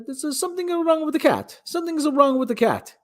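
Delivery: put-on a high, mildly accented old dad voice; lightly voiced father with a trace of accent; imitating his father. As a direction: fret like his worried dad. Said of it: "Still couldn't shake the accent".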